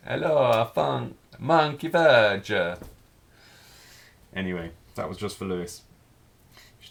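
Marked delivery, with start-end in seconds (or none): 0.1-2.5 loud, slow, hammed-up Italian accent; mock Italian accent. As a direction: put on a playful Italian accent, high and drawn out. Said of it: "say it in Italian accent".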